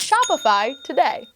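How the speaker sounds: loud, upbeat sponsor read. Acted. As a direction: pitch the sponsor with energy.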